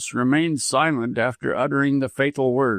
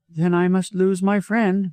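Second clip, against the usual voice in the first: firm, higher-pitched woman; determined; 6 semitones higher than usual. A narrator's reading. Widow gives doctor an ultimatum, determined. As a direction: state it resolutely, pitched up. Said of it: "said Missus Moxton determinedly".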